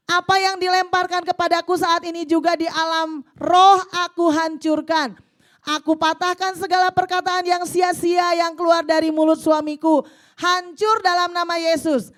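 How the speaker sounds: loud, impassioned prayer voice read to the congregation; fervent prayer reading. Acted. Preacher reading a wife's prayer aloud, fervent and loud. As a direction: cry out the prayer like a battle cry.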